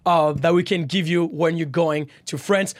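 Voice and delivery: ordinary delivery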